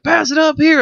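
very high loud cheer; cheering call. Retold or imitated. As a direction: call out like a party crowd. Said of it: "being like".

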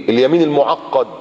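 ordinary talk, nothing marked

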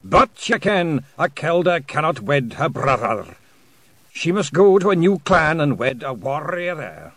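loud, high voice with rolled R's; clear, drum-roll R's. A narrator's reading. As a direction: declaim it clearly, rolling every R like a drum. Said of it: "sounding his R's like a drum roll".

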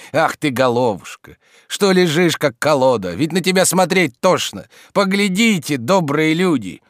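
high, exasperated lament; despairing. A narrator's reading. Servant railing at his sleeping master, despairing. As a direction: build into a loud lament. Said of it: "говорил Захар в отчаянии".

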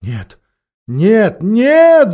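wailing no, rising to a high cry; howling beasts. Acted. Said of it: "завыли звери".

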